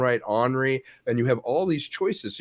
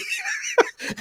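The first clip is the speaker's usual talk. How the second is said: high laugh. Real, not acted. Laughing at the story.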